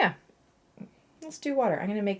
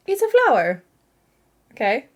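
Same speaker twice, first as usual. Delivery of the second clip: high, loud jokey declaration; mock announcement; 8 semitones higher, 10 dB louder than usual. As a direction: sing it out much higher and louder. Mock-proudly presents her botched flower.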